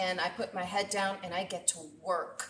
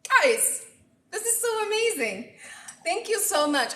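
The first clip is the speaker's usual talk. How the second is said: much higher and louder, thrilled; overjoyed; 11 semitones higher, 5 dB louder than usual. Real, not acted. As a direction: burst out with real joy. Overjoyed by viewers' gifts.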